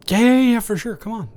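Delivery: high, loud burst of yeses; eager welcome. Acted. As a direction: jump in overeager to let them in. Eagerly inviting the neighbor in.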